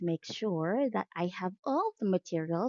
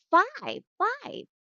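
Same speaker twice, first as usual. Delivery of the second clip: "Five, five" pitched far up, second rising higher; high modelling; 13 semitones higher than usual. Shown as a demonstration. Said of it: "One more time".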